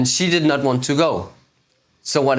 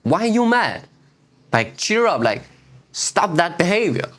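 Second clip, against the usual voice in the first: getting mad; 5 semitones higher than usual. Retold or imitated. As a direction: let the irritation boil over, retold. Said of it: "I became mad at her".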